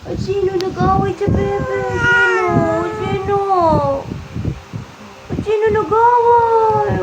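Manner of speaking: put-on indignant on the baby's behalf, playfully high; mock-indignant baby talk.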